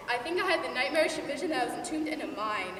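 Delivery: ordinary delivery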